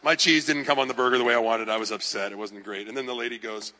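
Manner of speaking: ordinary delivery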